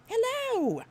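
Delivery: "Hello" sung out high, falling at the end; very high, drawn-out hello; high-pitched greeting. Real, not acted. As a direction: squeal a long "Hello" to a favorite viewer. Greeting a viewer, exaggerated repeat.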